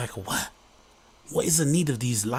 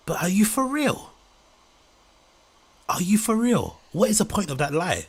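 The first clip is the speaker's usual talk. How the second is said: high-pitched, louder disbelief; incredulous; 9 semitones higher, 4 dB louder than usual. Real, not acted. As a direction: challenge an obvious tall tale in sheer disbelief. Incredulous reaction to a claimed story.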